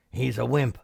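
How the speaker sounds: put-on crook voice; burglar. A narrator's reading. Second burglar reassuring the first, put-on crook voice. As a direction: dismiss the danger in a burglar's character voice.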